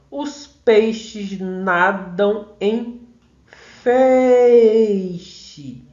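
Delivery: slow syllable-by-syllable line, landing long and loud on "feixe"; stressed-syllable scansion. Shown as a demonstration. Teacher showing students the tonic in "feixe", drawn-out scansion. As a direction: chant it out, holding the first syllable of "feixe". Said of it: "A tônica é feixe".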